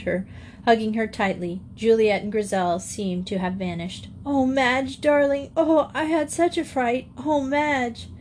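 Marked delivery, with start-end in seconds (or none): 4.3-8.1 put-on high, plaintive child's voice; cling to your sister in high-pitched distress; tearful distress. A narrator's reading. Joey hugging Madge after such a fright, tearfully distressed.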